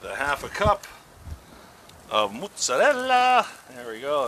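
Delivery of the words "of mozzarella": slow, high, hammed-up Italian accent; badly faked Italian, drawn out and high; horrible Italian accent. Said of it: "That's a horrible accent".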